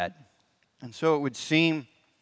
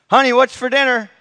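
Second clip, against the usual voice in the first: calling out louder and higher, closer now; 10 semitones higher, 14 dB louder than usual. Retold or imitated. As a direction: holler it again, more insistent. Husband calls out to wife again.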